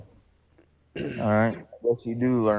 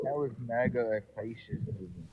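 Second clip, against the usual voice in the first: underwater; 3 semitones higher, 8 dB quieter than usual. Real, not acted. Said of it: "sounds like you're underwater".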